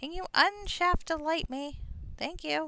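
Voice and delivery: high, slow little fairy voice; pixie voice. Acted. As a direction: ask sweetly like a pixie. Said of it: "how to do a pixie voice".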